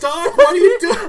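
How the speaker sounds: put-on high, loud panicked teenager; hammed-up alarm, breaking into laughter; Marty. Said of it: "Marty's gotta have".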